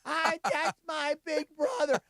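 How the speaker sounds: put-on high, slow, shouted drunk brag about her brother; drunk sister. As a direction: play the tipsy sister yelling with pride, drawn out. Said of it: "My sister's off to the side going".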